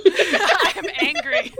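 loud, slow, high joke anger; laughing fake fury; mock anger, laughing. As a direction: pretend to be angry while cracking up. Jokes she was the one hissing.

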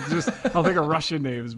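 said through real laughter; laughing. Real, not acted. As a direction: keep laughing as you talk. Laughing through own guessing.

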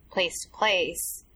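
ordinary delivery

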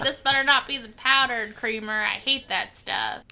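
lightly voiced grumpy agent; a hint of Tommy Lee Jones; Tommy Lee Jones impression. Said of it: "Was that your Tommy Lee Jones impression".

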